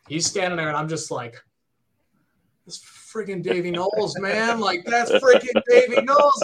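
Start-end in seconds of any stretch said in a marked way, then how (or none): 2.7-6.4 higher, louder burst of starstruck disbelief; freaking out. Retold or imitated. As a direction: freak out like a fan spotting an idol. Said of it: "You know, I'm freaking out".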